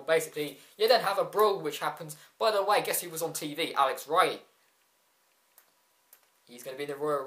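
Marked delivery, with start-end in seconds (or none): none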